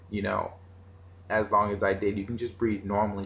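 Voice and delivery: usual speaking voice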